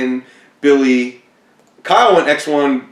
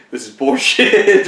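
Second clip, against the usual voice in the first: retold angry cursing; 5 semitones higher, slower than usual. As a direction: spit it out slowly like you're fed up with the ruling. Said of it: "I remember cursing the judge out".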